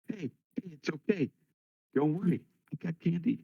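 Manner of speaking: high, soft, soothing voice; coaxing a child. Acted. Player's character soothes a wailing kid, soft coaxing.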